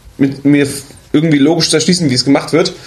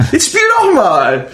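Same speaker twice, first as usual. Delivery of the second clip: insistent shout; 10 semitones higher, slower than usual. Retold or imitated. Mock gift-giver urging to play.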